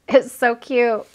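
ordinary delivery

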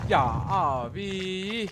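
long drawn-out exasperated whine. Real, not acted. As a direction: drag out the complaint very slowly. Exasperated after failing in the game. (uzata uzata isyan ederek)

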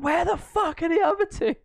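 high, loud laughing mock shout; mock yell. Imitating Mark yelling about missing teammates, laughing mock shout. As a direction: shriek it like a furious teammate. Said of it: "he was like".

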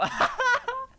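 high-pitched burst of laughter; squealing giggle that bursts out; real laugh. Real, not acted. Gamer laughing without knowing why, high giggle. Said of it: "I don't know why I'm laughing".

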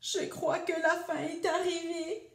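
high-pitched acted whimper; hammed-up storybook sobbing. A narrator's reading. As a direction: wail it high, as if in tears. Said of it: "sanglota Homard".

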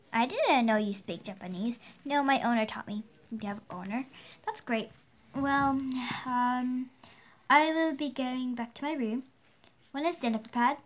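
low, quiet pet voice; a lightly voiced pet with a Japanese accent; Ling Ling the pet, a hint of a Japanese accent, said low and soft. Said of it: "she still has her Japanese accent".

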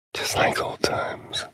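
slow, hushed whisper. Acted. Whispered aside in game.

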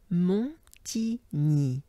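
Montigny said very slowly, syllable by syllable; slow teaching demo. Shown as a demonstration. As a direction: break Montigny apart slowly. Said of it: "So there's T".

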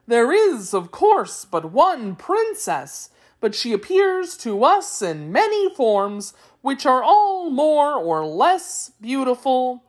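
high, singsong creature voice with big swoops; explaining Wheeler. A narrator's reading. Wheeler explaining the royal family, high singsong voice. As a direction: tell it in exaggerated, swooping tones.

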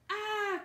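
high, loud shriek of surprise; startled cry. A narrator's reading. Annie sees a figure above the pit, startled cry. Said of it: "cried Annie".

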